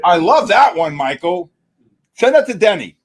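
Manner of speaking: loud, delighted praise. Real, not acted. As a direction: cheer the sound you loved, loud. Delighted by the roar sound.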